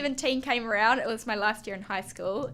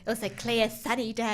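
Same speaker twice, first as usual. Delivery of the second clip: put-on old crone voice. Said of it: "You're using an old woman crone voice, just quietly".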